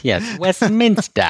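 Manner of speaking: high, slow mock British Westminster; hammed-up British accent, high and slow. Shown as a demonstration. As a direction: draw out Yes, Westminster. like a Brit. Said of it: "that's how it's supposed to be pronounced".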